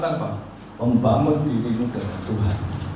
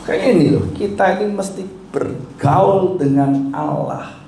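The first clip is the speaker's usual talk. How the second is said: rising preaching; 8 semitones higher than usual. Acted.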